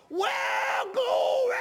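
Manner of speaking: put-on very high, loud, drawn-out toddler holler; imitating child's shout. Two-year-old shouting up at the pulpit, imitated high holler. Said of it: "As a two-year-old child".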